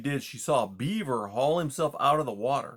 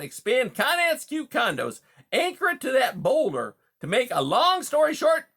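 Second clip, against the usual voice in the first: loud, high smooth-talking hustler; a slick wise-guy beaver selling hard; fast-talking beaver; 13 semitones higher, 6 dB louder than usual. A narrator's reading. Beaver Conrad speaks.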